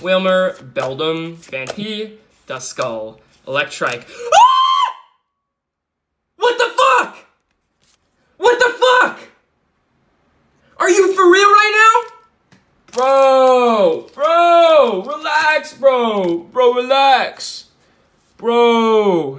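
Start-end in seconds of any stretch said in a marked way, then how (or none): 4.3-5.0 high scream of surprise; excited scream. Real, not acted. Rare pull, screams. 6.4-19.4 much higher, loud hyped yelling; bro, shout it out hyped; frat bro. Real, not acted. Hyped reaction to rare pull.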